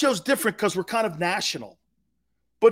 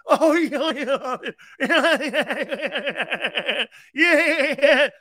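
higher, laughing fake chuckle of a host; corny radio laugh; 8 semitones higher, 6 dB louder, much slower than usual. Radio host laughing along at a call, hammed-up. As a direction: ham up a phony on-air laugh. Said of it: "It's the corniest laugh".

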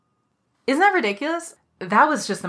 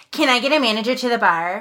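higher, louder sneering copy of a radio call; mocking imitation; 5 semitones higher, 4 dB louder than usual. Server imitating the coupon lady mocking her walkie call, sneering. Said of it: "grabs a pretend walkie-talkie and goes".

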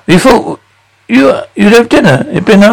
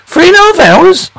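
indignant disbelief; 11 semitones higher than usual. Acted. Angry father shocked at wait.

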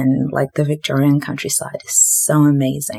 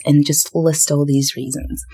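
ordinary talk

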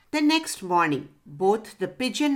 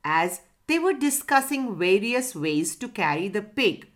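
plain reading voice

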